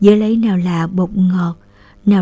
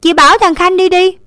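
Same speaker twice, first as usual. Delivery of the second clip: higher, louder big-sister order; bossy big sister; 10 semitones higher, 6 dB louder than usual. A narrator's reading. Eldest sister assigning the errand to little brother, bossy. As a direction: lay down the law as the eldest. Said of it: "Linh lên giọng chị lớn".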